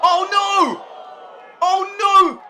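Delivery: high, loud cry of alarm; shocked shout. Real, not acted. Commentator seeing the referee taken out, alarmed shout. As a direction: gasp it out as the ref goes down.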